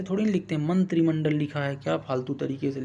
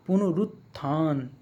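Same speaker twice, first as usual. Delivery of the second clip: पुनरुत्थान slowly, "punu-rut... thaan"; sounding it out; slower than usual. Shown as a demonstration. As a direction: split पुनरुत्थान with a drawn-out last syllable. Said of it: "ऐसे नहीं".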